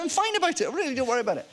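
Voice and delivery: retold protest, pitched well up; high-pitched reassurance. Actor retelling how he soothed his annoyed co-star, high reassurance. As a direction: wave it off in a high voice.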